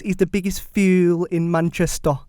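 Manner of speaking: loud, slow ranting fan; Andy Tate impression. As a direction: rant like an angry football fan. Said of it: "Is that Andy Tate?".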